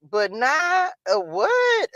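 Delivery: high, slow incredulous question; mock disbelief. Real, not acted. Reacting to ASMR claim, high and slow. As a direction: stretch it in disbelief.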